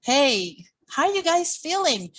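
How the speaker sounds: high bright call to the class, rising; cheerful greeting demo. Shown as a demonstration. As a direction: greet the kids with energy.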